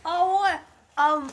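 talking around a lollipop; sucking a lollipop. Real, not acted. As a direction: mouth full of lollipop. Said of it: "Sorry, I have a lollipop".

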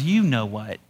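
low, softened, knowing punchline; mock-menacing parrot taunt; sly parrot. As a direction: drop it way down, insinuating.

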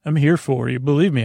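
ordinary talk, nothing marked

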